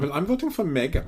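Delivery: put-on German accent. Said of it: "the new catchphrase".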